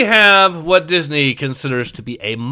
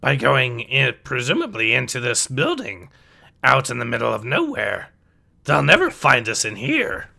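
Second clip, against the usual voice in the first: put-on old sage's character voice with swooping pitch; Yoda's voice; 4 dB quieter than usual. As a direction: play the little wise master urging them to hide.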